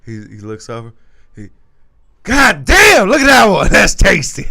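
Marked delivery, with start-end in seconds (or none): 2.2-4.5 high, loud leering holler; mock drunk admiring holler; imitating a friend hollering at a passing girl; loud catcall. OG catcalling in front of girlfriend.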